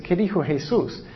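normal talking voice

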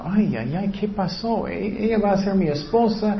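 soft, dismayed thinking aloud; imitated worried murmur to himself; inner thoughts. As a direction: voice Joseph's private shock, hushed. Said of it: "estoy seguro que él estaba pensando".

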